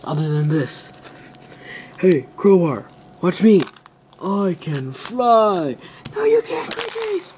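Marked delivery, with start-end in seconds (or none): 2.0-5.8 boasting toy robot. Pretend. Toy figure boasting in play. 6.1-7.3 other toy replying. Pretend. Other toy answers in play.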